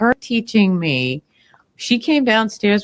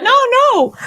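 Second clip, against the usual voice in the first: high, louder quoted no; gentle refusal; 12 semitones higher, 5 dB louder than usual. Retold or imitated. Friend refusing help, higher gentle no. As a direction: wave off the help kindly. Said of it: "And she's like".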